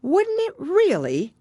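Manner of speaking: put-on very high, slow, astonished girl; Alice amazed; surprised Alice. A narrator's reading. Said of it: "in a tone of great surprise".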